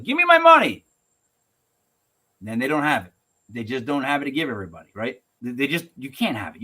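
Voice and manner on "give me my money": high, loud mock shriek; hammed-up yell demanding cash; panicked crowd demand. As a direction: shout it like a bank-run mob. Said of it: "everybody goes to the bank all at once".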